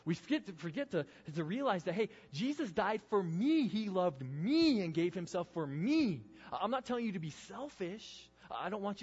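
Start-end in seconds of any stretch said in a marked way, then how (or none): 1.9-6.2 slow, loud, high stretch on every "me"; emphatic "me". Acted. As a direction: preach it, hammering each "me". Preacher making the verse personal.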